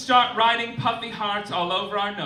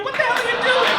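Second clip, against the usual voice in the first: high, loud, laughing disbelief; laughing shriek of disbelief; incredulous squeal; 6 dB louder, much faster than usual. Real, not acted. Incredulous rant at Kansas.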